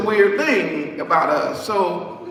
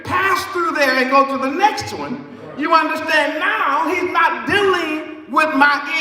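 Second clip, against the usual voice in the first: preaching at full force; 6 semitones higher than usual. Acted. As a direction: lift the whole room with it.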